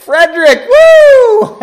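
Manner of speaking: very loud, high whoop; real delighted outburst, shouted out; excited cheer. Real, not acted. Reader cheers the character's entrance.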